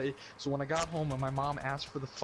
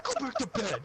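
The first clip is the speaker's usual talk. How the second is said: much higher exasperated order; high mock mom. Mom ordering son back to bed, high exasperated voice. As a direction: snap it like a fed-up mother.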